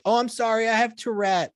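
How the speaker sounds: put-on high, light kid voice apologizing; imitating his son. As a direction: chirp it like a nine-year-old saying sorry. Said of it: "He goes".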